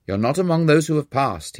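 mildly gloating; triumphant. Acted. Professor telling the student he failed, mildly triumphant. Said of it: "he said in triumph".

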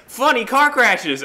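high, loud, slow announcer. Retold or imitated. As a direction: boom it like a show host. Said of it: "It was like".

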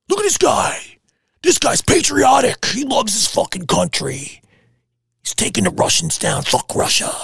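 mock the fans who swallowed the story, fading out; gullible fan. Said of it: "But everybody thought it was".

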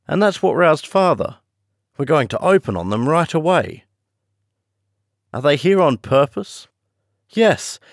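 put-on high girlish voice, urgent and tense; a startled young woman reporting an attack; young girl, alarmed. A narrator's reading. Girl wakes to aeroplane attack.

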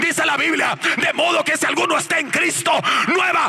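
high, shouted revival preaching; full-force preaching. Real, not acted. Preacher proclaims his transformation to congregation, full-force preaching. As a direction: build to ecstatic cries, stretching the climactic words.

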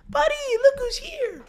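very high, slow, drawn-out coo; excited baby talk. Shown as a demonstration. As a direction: hype up the dog like a baby. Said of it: "excited tones".

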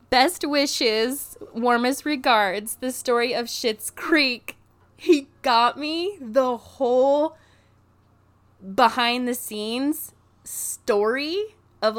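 slow, loud, awed reading of the gift; savouring each word in amazement; overwhelmed. Real, not acted. Reading gift book, emotional.

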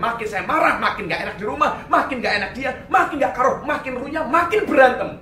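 usual speaking voice

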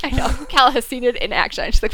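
ordinary talk

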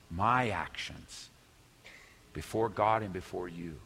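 hushed, earnest confession; sincere repentance. Acted. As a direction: ask forgiveness humbly, voice dropped low. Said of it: "No, it's".

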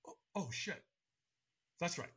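retold sheepish catch, said under the breath; startled realization. Walker remembering his training, startled and quiet.

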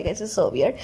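ordinary talk

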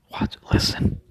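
hushed whisper, straining to hear. Real, not acted. To the viewer, listening for the neighbor's dog: whispered. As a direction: drop to a breathy hush so the dog can be heard.

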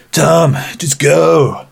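slow, high, dramatic sacrifice plea; heroic plea. Acted. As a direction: beg him to leave, slowly. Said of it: "And I'm like".

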